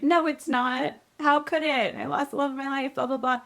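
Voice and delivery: high-pitched, self-mocking wail; mock heartbroken protest. Daughter rejects mom's hopeful promise, mock-despairing. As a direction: whine it in a high voice, sending up your own heartbreak. Heartbroken retort to mom, retold.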